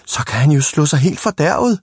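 high, panicky cry; shouted alarm. A narrator's reading. Big boys fear Ivan will get badly hurt, shouting. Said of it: "råbte de store drenge".

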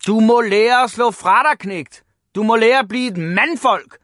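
high, loud lecturing shout; loud scolding. A narrator's reading. Father telling Ivan to fight back, shouting. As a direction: bellow orders at your son. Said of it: "at råbe meget højt".

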